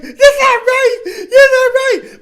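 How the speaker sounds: very high, loud wailing cry; hammed-up sobbing shriek, words stretched; mock crying. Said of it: "so she starts crying".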